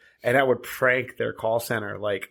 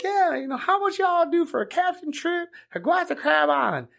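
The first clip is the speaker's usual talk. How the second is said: high Kentucky caller caricature; mock Kentucky customer, pitched way up; David. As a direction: ask eagerly in a high put-on country voice. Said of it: "he's from Kentucky".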